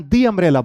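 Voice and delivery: "दी" with ee before "अम्ब्रेला"; "dee" before a vowel. Shown as a demonstration. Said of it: "इसकी pronunciation भी जो क्या हो जाएगी".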